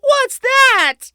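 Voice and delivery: very high, loud panicked cry; alarmed cry. A narrator's reading. Mother realising the baby is gone, high and panicked. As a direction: gasp in sudden fear. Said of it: "Mother cried".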